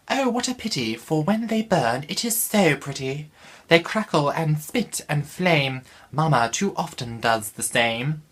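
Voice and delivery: Harriet as a very high, posh royal lady; the Queen. Acted. Vlogger reads Harriet to viewers as a high royal lady. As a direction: read it like the Queen herself. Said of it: "Let's go with it".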